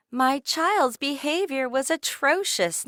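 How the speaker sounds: a parent airing grievances in the park; complaining parent. A narrator's reading. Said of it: "eavesdrop on a parent".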